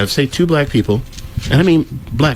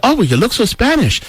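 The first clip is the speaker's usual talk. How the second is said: mocking quote; 9 semitones higher than usual. Mocking the lady's remark.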